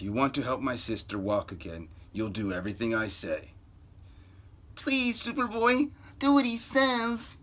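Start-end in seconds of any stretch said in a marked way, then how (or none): 4.7-7.4 put-on high pleading young girl voice. Lori begging Superboy to obey, high girl plea. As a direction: beg sweetly in a girl's voice.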